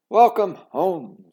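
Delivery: slow, loud, high greeting; warm welcome. A narrator's reading. Mother welcoming her daughter home, warmly. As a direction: open your arms with it.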